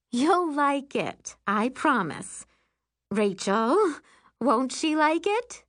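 high, coaxing mom voice; reassuring mother. Acted. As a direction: persuade a reluctant child brightly. Mother reassures, enlists sister.

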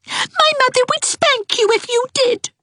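crying out. Acted. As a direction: cry out the threat indignantly. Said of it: "cried Ivy".

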